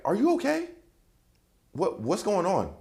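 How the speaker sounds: high, slow, concerned woman's voice; girlfriend's worried voice. Retold or imitated. Girlfriend at the doorway asking what's wrong, high and worried. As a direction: she worries over him. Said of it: "And she's like".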